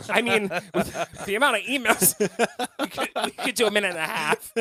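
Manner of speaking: high laughing talk. Real, not acted. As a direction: laugh while speaking. Laughing at emails joke.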